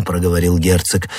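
plain reading voice

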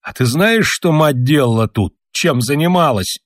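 put-on a grown man whining like a little boy; plaintive. A narrator's reading. Father complains to his son, plaintive and childlike. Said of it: "жалобным голосом, как маленький".

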